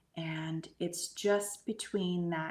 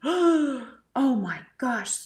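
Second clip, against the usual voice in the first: slow, high, loud gasping oh my gosh; waking up in a sweat, gasping in shock; startled gasp; 6 semitones higher, 10 dB louder, much slower than usual. Retold or imitated. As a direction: jolt awake in fright. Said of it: "I went, gasps".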